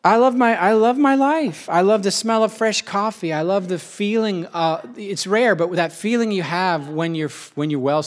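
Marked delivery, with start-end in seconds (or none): 0.0-3.3 joyful. Real, not acted. Bursting love of life.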